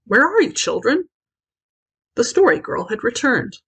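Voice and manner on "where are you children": high, loud cheerful call; blithe calling. A narrator's reading. Story Girl calling the children from below, blithe call. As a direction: call up to them brightly. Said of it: "a blithe voice calling".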